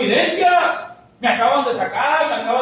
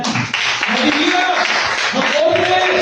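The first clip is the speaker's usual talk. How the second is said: emphatic command; 9 semitones higher, 5 dB louder, much slower than usual. Acted. Preacher voicing God's command.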